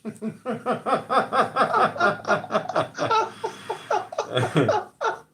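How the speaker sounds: a long high-pitched fit of laughing; cracking up at the sign; high laughter. Real, not acted. Friend laughing at the lottery sign joke, high laughter. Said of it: "it just broke me up".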